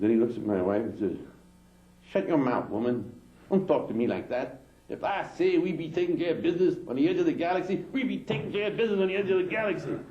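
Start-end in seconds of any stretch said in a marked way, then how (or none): 2.1-10.0 a touch of a scolding alien. Acted.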